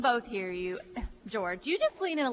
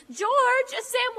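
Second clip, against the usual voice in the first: calling out; 9 semitones higher, 5 dB louder than usual. Acted. Calling George to the phone.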